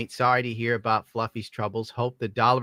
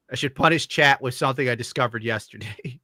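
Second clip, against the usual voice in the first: ordinary talk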